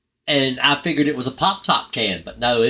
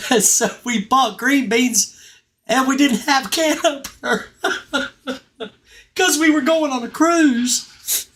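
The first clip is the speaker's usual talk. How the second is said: high, slowed words breaking up with real laughter; giggling through the story, barely holding it together; laughing while talking; 14 semitones higher, slower than usual. Real, not acted. Vlogger laughing at his own Thanksgiving memory, giggling through the words. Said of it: "I'm crying, laughing".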